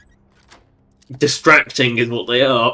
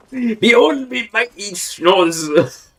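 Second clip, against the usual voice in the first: high, slow hammed-up announcement; mock-grand proclamation; 8 semitones higher, much slower than usual. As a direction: draw it out like a pompous unveiling. Repeats mock unveiling of armor.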